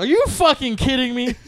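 high, loud retold outburst. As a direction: raise your voice in exasperated disbelief. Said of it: "I started raising my voice".

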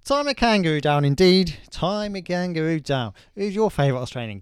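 loud hammed-up Aussie, slowing on the song line; loud broad Australian accent. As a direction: keep the broad Queensland twang going. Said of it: "I can do Australian".

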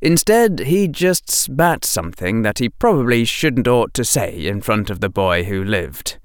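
ordinary delivery, nothing marked

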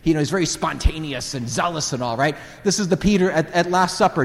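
normal talking voice

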